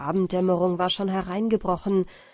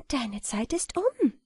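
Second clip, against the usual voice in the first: a hint of a higher, gentle fairy voice; lightly voiced fairy Wieglinde; 4 semitones higher than usual.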